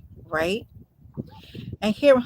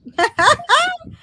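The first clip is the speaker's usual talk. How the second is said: high bursting laugh, much louder; big real laugh; 8 dB louder than usual. Real, not acted. Host cracking up at Joe's joke, loud laugh.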